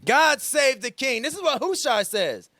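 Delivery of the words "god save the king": raised, slow, ringing proclamation; grand proclamation. Shown as a demonstration. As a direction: hail the king loudly and slowly. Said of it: "pastor demonstrates Hushai's line".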